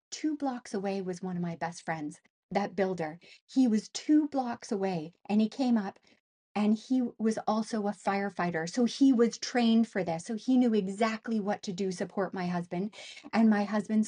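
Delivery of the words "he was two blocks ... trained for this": ordinary delivery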